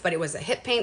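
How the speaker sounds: ordinary delivery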